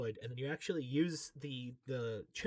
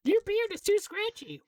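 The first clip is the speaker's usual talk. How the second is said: mock lover's complaint; 9 dB louder than usual. Mock couple quarrel.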